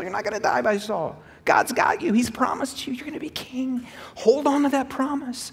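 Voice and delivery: high, urgent exhortation; rally him to hold on to the promise; fervent preaching. Real, not acted.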